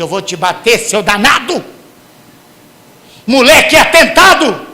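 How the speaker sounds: plain start building to a loud high mock yell; angry parent. Parent scolding a naughty child, building mock yell. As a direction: let the threat swell into shouting. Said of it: "que você não diga".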